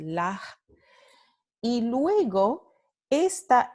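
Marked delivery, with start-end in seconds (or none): 0.0-0.4 La with a breathy h where the s was; dramatic aspiration. Shown as a demonstration. Teacher exaggerating aspirated s. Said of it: "I'm trying to do it really dramatically".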